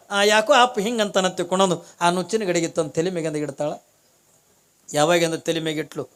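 ordinary talk

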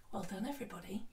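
ordinary talk

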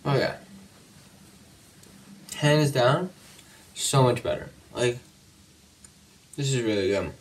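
eating and talking; talking while chewing. Real, not acted. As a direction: mouth partly full. Seen 0: Bites cookie at -4; lips pressed, chewing motions while talking after.

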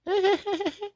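faint high cackle very far away; a cackle barely heard. Acted. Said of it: "Way off in the distance".

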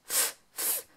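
unvoiced breathy "F, f"; whispered letter sound. Shown as a demonstration. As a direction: puff the "F, f" sound out. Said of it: "phonics sound demo".

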